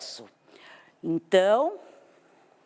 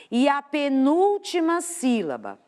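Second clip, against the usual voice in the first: ordinary talk, nothing marked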